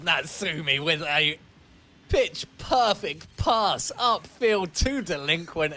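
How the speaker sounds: loud, high, drawn-out commentator's call; excited goal call. Real, not acted. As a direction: savour each word of the brilliant pass. Celebrates a goal on replay.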